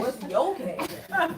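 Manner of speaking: high, loud, slow laughing mock ask; mock drawn-out question. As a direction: stretch it out high and loud through laughter, mocking "What's your cash app?". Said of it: "I'm screaming".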